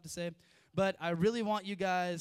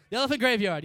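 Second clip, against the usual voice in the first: loud, high burst of recognition; excited shout of the remembered answer; sudden recall; 6 semitones higher, 12 dB louder, much faster than usual. Real, not acted. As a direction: blurt it out as the name comes back. Suddenly recalls the forgotten name.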